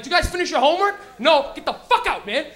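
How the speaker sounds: loud, high, demanding teacher; strict teacher. Retold or imitated. Teacher to class about homework, high and loud. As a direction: bark the question and order like an irate teacher. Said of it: "and he'd be like".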